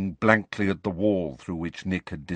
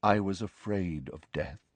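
quiet, subdued confession; soft; 2 semitones lower, 6 dB quieter than usual. A narrator's reading. Nick confesses his fear to Harry, softly. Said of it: "said Nick softly".

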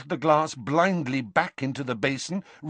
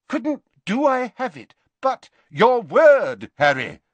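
pressing demand; 11 semitones higher, 8 dB louder than usual. A narrator's reading. Dumbledore pressing Harry.

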